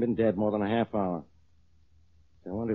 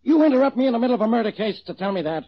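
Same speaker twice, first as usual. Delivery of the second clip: loud, indignant scolding of the officer; exasperated outburst; 10 semitones higher, 5 dB louder, faster than usual. Acted. Inspector scolding his officer for interrupting, loud and indignant. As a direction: let your temper boil over at him.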